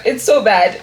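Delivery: ordinary delivery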